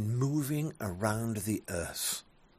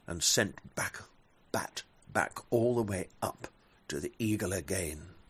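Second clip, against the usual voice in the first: ordinary delivery, nothing marked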